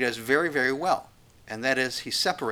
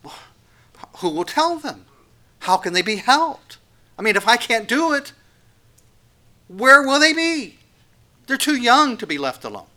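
rising, pleading cry of a worried parent, higher and louder; anxious parent; 9 semitones higher, 7 dB louder than usual. Acted. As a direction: fret aloud for your children, voice climbing. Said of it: "as a parent, you're thinking".

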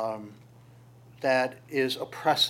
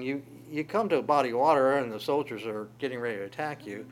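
ordinary talk

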